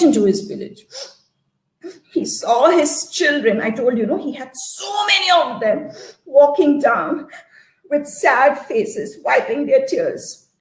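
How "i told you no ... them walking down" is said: plain reading voice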